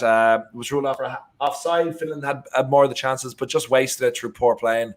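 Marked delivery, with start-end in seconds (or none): none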